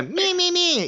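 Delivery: very high, squeaky Beaker voice. Retold or imitated. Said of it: "that's how he says".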